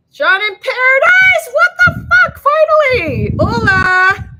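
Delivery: excited shout. Real, not acted. Viewer she knows joins chat.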